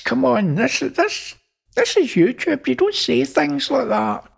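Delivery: very high-pitched reprimand; high, pleading telling-off; flustered scolding. Acted. Shocked scolding of ranting Elvis.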